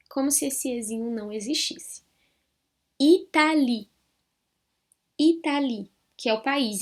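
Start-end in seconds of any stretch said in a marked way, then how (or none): 3.0-5.9 "Italie" with final e silent, i-ta-li; French Italie. Shown as a demonstration. Teacher models "Italie" with silent final e. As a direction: say "Italie" ending on i, no e. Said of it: "esse E aqui eu não pronuncio".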